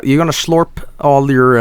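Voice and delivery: normal talking voice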